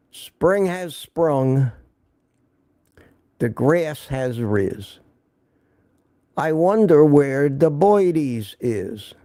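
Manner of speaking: put-on slow, drawn-out Brooklyn garbage collector accent. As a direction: recite the poem like a Brooklyn garbage collector, very slowly. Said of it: "in that unmistakable accent, and I can only hope to maybe imitate it".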